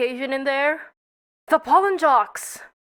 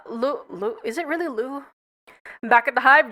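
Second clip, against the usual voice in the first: usual speaking voice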